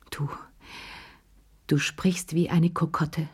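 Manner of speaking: hushed, halting stammer. A narrator's reading. Humiliated wife stammering at her husband, hushed. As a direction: stumble over the words, shaken. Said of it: "stammelte sie".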